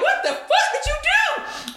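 very high, stunned disbelief; mock-shocked high squeak; stunned squeal. Retelling climax, stunned reaction.

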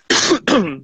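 sudden choking cough; sputtering cough after swallowing wrong; choking on a drink. Real, not acted. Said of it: "Desculpa que eu só engasguei aqui".

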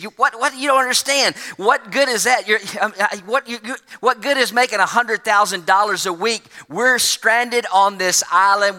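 panicked castaway sputtering at his calm friend; sputtering disbelief. Retold or imitated. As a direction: trip over the words in shock. Stranded man sputters in disbelief.

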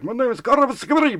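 high, fast jumble of a foreign name; old-world European man. Retold or imitated. Old customer giving his long name, high, fast mock European voice. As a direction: imitate an old European rattling off an unpronounceable name. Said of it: "his name had so many fucking, uh, consonants".

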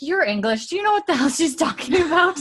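high, disbelieving aside; incredulous friend. Retold or imitated. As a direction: ask in shocked disbelief. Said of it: "Louise looks at Claire and is like".

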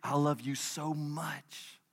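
a dad whispering to his son at bedtime, slow and soft. Retold or imitated. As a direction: breathe it into a child's ear. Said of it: "I whisper in their ears".

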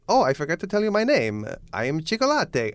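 hammed-up comic Italian accent; stupid Italian accent. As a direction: introduce yourself as a silly Italian clown. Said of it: "in this stupid Italian accent".

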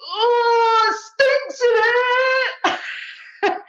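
high, loud, very drawn-out cry of disgust; loud disgusted girl. Retold or imitated. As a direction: wail it like a drunk girl hit by the smell. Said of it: "she goes".

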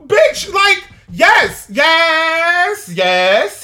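high, loud, emphatic shouted yeses; furious yes. Real, not acted. Vlogger shouting agreement at the woman, furious. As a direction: hammer each yes at her.

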